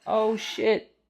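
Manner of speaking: high, squeaky surprised little voice; a small creature finding someone stuck; mouse voice. Acted. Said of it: "he's like".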